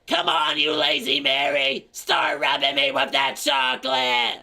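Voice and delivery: put-on high, loud bossy old-lady shout. Old lady ordering lazy daughter to rub chocolate, loud high yell. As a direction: order her about at the top of your voice. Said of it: "the old lady's like".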